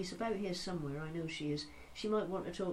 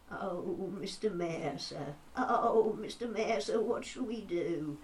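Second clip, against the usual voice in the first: wavering, drawn-out plea from a lamb; Larry the Lamb; 2 semitones higher, slower than usual. Retold or imitated. Anxious lamb asking the mayor for help, bleating plea. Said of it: "Larry the Lamb".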